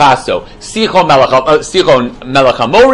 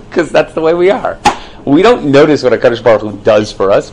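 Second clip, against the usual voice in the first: ordinary talk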